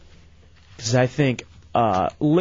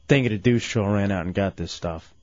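normal talking voice

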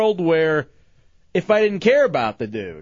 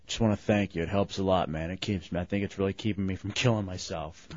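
very low, hushed impression; quiet, low, downcast thanks; despondent friend; 12 semitones lower, 10 dB quieter than usual. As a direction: imitate a grateful friend at rock bottom. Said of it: "And he goes".

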